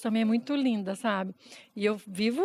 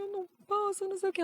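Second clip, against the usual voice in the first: high-pitched, overwhelmed objection; retold anxious protest; 9 semitones higher, 4 dB quieter than usual. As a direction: protest helplessly, replaying your own worry.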